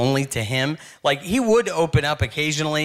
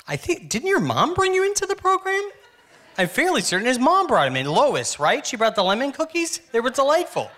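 high, sugary mock counselor voice; mock sweet counselor; 9 semitones higher than usual. Comic mocking a tough guy in a high, sugary voice. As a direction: chirp it up high like a sweet church lady.

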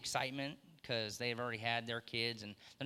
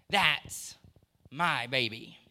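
louder, proud and tender emphasis on a father's claim; 5 dB louder, much slower than usual. Acted. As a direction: say it like a father bursting with pride. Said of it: "he can proudly say".

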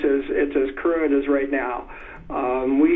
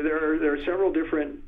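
normal talking voice

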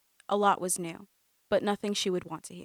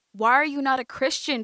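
loud, higher, desperate scream; 5 semitones higher, 9 dB louder than usual. A narrator's reading. Cousin screams at Amanda, desperate. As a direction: scream the question at her. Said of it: "she screamed".

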